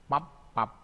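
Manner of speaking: "pap" high and loud, lips shut on the final p; teacher drilling final p in "pap"; batchim demo. Shown as a demonstration. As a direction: punch out "pap" for the students. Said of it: "bacanya sama".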